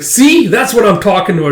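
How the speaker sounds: high, loud "see", then easing down; acted vexed exclamation; exasperated point. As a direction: complain that you were proved right. Exasperated brother proves his point.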